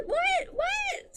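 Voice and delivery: very high, thin "what?"; incredulous squeak. Real, not acted. Reader baffled by billionaire cliché, high incredulous squeak. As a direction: squeak it in disbelief. Mock disbelief at brooding hero cliché.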